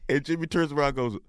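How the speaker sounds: usual speaking voice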